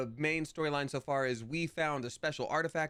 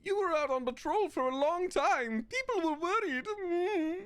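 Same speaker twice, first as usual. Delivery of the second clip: much higher character voice; 4 dB louder, slower than usual. Acted. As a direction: voice the worried hero up high. Voicing player character dialogue.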